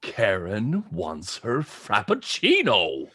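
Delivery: low, lightly put-on demanding customer; insistent customer. Player insists in character.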